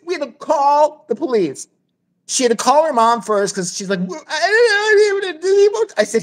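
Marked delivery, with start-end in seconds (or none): 4.0-5.9 high, garbled mock crying; retold tearful wail. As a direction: blubber it like a distraught girl. Imitating distraught girlfriend phoning mom.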